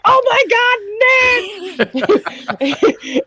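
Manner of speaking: very high, slow, drawn-out shriek of joy; excited squeal. Retold or imitated. Reliving the portal scene, shrieking with joy to friends. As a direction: squeal his name like a thrilled fan. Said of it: "I was like".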